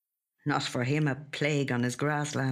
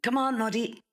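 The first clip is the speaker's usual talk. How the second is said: high call carried outdoors; calling the dog; 9 semitones higher than usual. A narrator's reading. Joe calling his collie outside at night, high call. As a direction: call the dog from across the yard. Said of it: "he calls to the collie".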